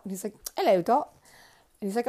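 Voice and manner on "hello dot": higher, lightly attempted Cockney. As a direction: greet her in an imitated Cockney accent. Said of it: "the Cockney accent".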